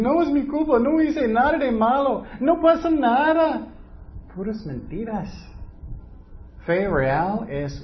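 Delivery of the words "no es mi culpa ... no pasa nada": much higher, louder indignant denial; mock victim. Preacher mocks excuse-makers, much higher and louder whine. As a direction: protest your innocence, squeaky and offended. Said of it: "y muchos dicen".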